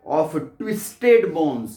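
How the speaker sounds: each word read out slowly and clearly; slow poem reading. Shown as a demonstration. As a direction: read the line slowly, as for a class. Teacher reads poem line aloud. (धीमे-धीमे कविता पढ़ना)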